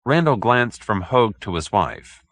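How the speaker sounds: ordinary delivery, nothing marked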